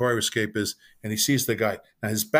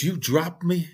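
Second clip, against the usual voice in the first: higher-pitched, imitated disbelief; mock-shocked question, pitched up; incredulous question; 6 semitones higher, slower than usual. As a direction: ask it like a kid brother who can't believe it. Retold brother's shocked question.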